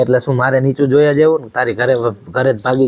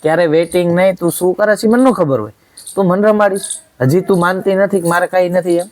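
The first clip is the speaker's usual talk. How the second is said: heated husband yelling at his wife; angry yelling; 6 semitones higher than usual. Real, not acted. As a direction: hurl it at her, pitch climbing. Husband accusing wife, rising fury.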